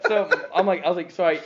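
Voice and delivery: words breaking into laughter; real loud laughing. Real, not acted. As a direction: giggle through the words.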